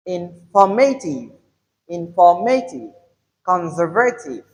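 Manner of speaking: inFORmative, inFORmative, conSERvative, falling after the stress; "informative" and "conservative" stressed on their second syllables; stress third-from-last syllable. Shown as a demonstration. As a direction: punch FOR in "informative" and SER in "conservative". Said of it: "where you have the stress on the third".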